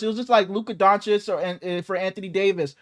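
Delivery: normal talking voice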